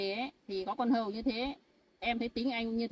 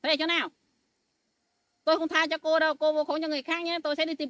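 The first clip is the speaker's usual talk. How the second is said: loud high angry threats; furious threat; 6 semitones higher, 8 dB louder than usual. Acted. Wife loudly threatening the maid who slandered her. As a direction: threaten her, raising your voice.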